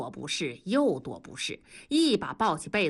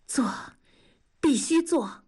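sudden firm decision, second phrase higher; abrupt resolve; 8 semitones higher than usual. A narrator's reading. Wife deciding aloud beside sleeping husband, abrupt resolve. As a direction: blurt it out after a silence. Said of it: "突然说".

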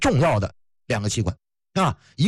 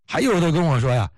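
ordinary talk, nothing marked

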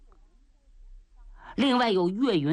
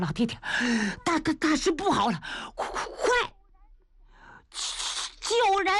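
panicked son; 6 semitones higher than usual. Acted. Son rushes in with bad news.

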